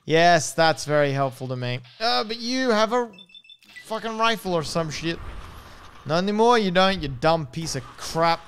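ordinary delivery

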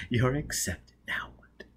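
breathless whisper at the ball; whispered aside. Acted. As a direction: keep it under your breath so no one hears.

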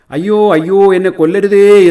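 high, loud cry for mercy; terrified wail. A narrator's reading. Crane gripped by the crab begs for its life, wailing. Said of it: "എന്ന് കൊക്ക് നിലവിളിച്ചു".

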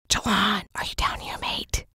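hushed, unvoiced calling; boy whispering for his lost friend in a dark tunnel; scared whisper. A narrator's reading. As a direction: whisper it, too scared to be loud. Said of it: "I whispered, too scared to speak any louder".

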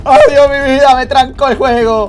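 high, loud wail of complaint; exasperated yelling. Real, not acted. Gamer wails that the game got him stuck, exasperated. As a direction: cry out in loud exasperation at the glitch.

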